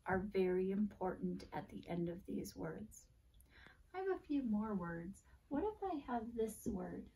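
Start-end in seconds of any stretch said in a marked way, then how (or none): none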